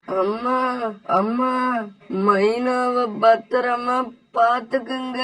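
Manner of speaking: slow, fading last words of a dying son. Acted. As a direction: breathe out the final request slowly. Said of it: "கடைசி நேரத்துல". (இறக்கும் மகன் அம்மாவிடம் மெதுவாக சொல்லும் கடைசி வார்த்தைகள்)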